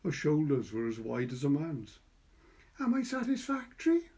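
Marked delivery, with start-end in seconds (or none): 2.8-4.1 put-on high, careful child's question; boy's voice. A narrator's reading. Boy asking Patricia after dressing neatly, high. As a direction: ask it up high like a tidy little boy. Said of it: "he asked".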